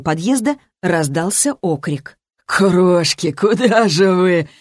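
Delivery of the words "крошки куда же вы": slow, drawn-out hoarse call; hoarse smoker's yell. A narrator's reading. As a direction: drunk smoker calling after girls. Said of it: "вопил прокуренный сиплый голос". (прокуренный сиплый окрик)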